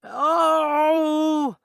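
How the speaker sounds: high, loud wailing cry "Oh!"; distressed cry. Acted. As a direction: let out a sudden anguished wail. Upset after "it's not funny.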